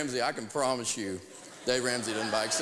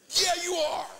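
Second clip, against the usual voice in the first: mock smug retort; 3 dB louder, slower than usual. Retort to salesman's apology.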